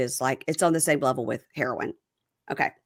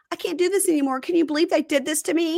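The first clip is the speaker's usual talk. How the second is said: high, lightly mocking whine of a self-pitying wife; whiny victim; 10 semitones higher, faster than usual. Retelling the wife's call, high mocking victim whine. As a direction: play the poor victim, mockingly. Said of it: "It was victim".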